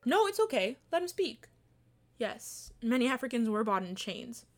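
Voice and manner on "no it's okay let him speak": higher, pointed challenge; defiant. Acted. Daring the official to keep talking, a defiant challenge. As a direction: dare him to go on. Cutting off the defender to confront the insult.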